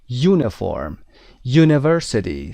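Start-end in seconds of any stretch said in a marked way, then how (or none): 1.5-2.5 University drawn out slowly, starting with yoo. Shown as a demonstration. Teacher slowly models the yoo sound for the class. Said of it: "صدای یو داریم".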